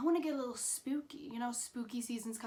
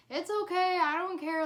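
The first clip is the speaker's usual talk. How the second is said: higher, louder bright reassurance; nice reassurance; 7 semitones higher, 6 dB louder than usual. Retold or imitated. Friend Morgan shrugging off being lost, lightly. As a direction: brush it off kindly, up high. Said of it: "being really nice about it".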